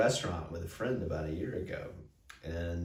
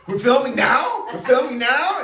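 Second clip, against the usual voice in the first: very high, loud rising disbelief; incredulous question; 11 dB louder than usual. Retold or imitated. Retelling the surprise at filming right now, high loud mock disbelief. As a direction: imitate escalating alarm, amused.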